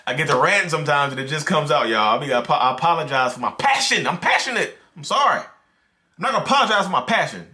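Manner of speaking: high, loud, worked-up voice; passionate rant. Real, not acted. Creator apologizing to viewers for ranting, heated. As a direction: defend the passion, fired up. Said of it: "You hear my voice change?".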